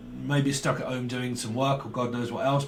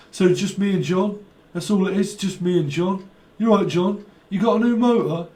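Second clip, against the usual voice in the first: higher-pitched Liverpool drummer impression; 4 semitones higher, 4 dB louder than usual. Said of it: "My name is [name]".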